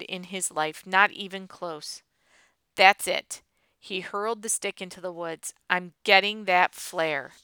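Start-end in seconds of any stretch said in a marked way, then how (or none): 2.7-3.4 loud, fed-up snap; angry outburst. Acted. Hurls stick in frustration. 5.7-7.3 angry determination. Acted. Storms off in frustration.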